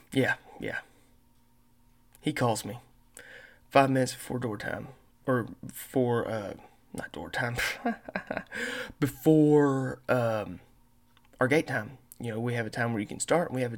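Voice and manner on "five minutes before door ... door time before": ordinary delivery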